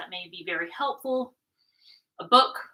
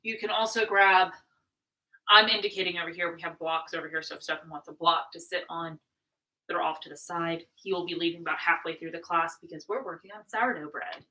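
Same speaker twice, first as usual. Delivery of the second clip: normal talking voice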